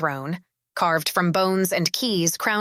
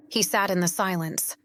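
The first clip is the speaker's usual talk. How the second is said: plain reading voice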